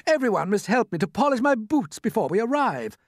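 high-pitched fretting creature voice; fussing centipede. A narrator's reading. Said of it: "the centipede said".

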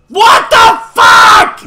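very loud, high, drawn-out yell; full-volume high gamer rage; outraged shout. Real, not acted. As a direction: bellow each word slowly in disbelief. Sudden setback in the game.

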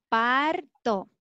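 ordinary talk, nothing marked

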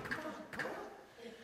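high, soft, drawn-out coaxing call; lightly laughing invitation, acted high and slow; playful coaxing Jesus. Jesus urging a blind follower to run, playful coaxing. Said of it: "And he's laughing".

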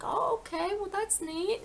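high, light retold surprise; pleasantly surprised reaction. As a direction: act out taking in interesting news. Retold reaction to ancestry news.

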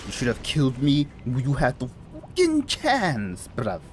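put-on sudden British accent, rising in pitch. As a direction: taunt in a mock British accent. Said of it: "I don't know why I said in that accent".

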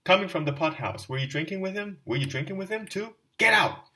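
loud, high accusing shriek ending in a scream; wife screaming at the stranger to get out; furious attack. Acted. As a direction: drive him out with fury. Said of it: "fell upon Raskolnikov".

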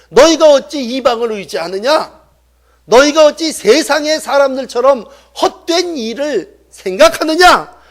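impassioned rhetorical questions from the pulpit; forceful preaching. Acted. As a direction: challenge the congregation, climbing in pitch.

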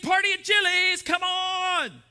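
high, whooping shout; rally everyone to party, loud and high; excited party shout. Acted. Acting out the party-loving temperament.

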